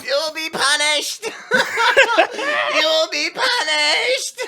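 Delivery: high villain voice breaking into laughs; laughing high-pitched threat. Retold or imitated. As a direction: cackle through the high curse. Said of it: "It's like that high-pitched fucking".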